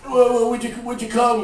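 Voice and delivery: high, loud, stuttering rock-star impression. Rock singer asking songwriter over, high stammering caricature. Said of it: "And he goes".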